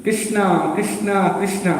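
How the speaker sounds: a parrot copying her gentle chant; Radharani's voice. Retold or imitated. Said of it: "in the voice of Radharani".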